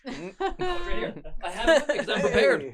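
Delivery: high, loud, through laughter; laughing boast. Real, not acted. Game master producing a prepared spaceship, laughing loudly. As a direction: proudly show it off while laughing.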